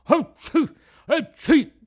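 two loud high sneezes; loud sneezing. A narrator's reading. Mangiafoco sneezes, moved by Pinocchio, loud sneezes. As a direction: let the sneezes burst out high. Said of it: "fece altri due starnuti".

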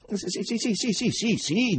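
high, rapid eager yeses; excited. A narrator's reading. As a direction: gush agreement nervously. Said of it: "diceva eccitato Berlioz".

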